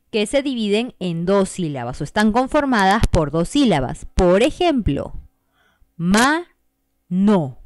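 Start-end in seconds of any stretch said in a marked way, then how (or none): 6.0-7.6 mano split into ma, no with a pause; syllable split. Shown as a demonstration. Teacher splitting mano into syllables for the class. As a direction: tap out ma, no as two beats. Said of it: "Son dos golpes de voz".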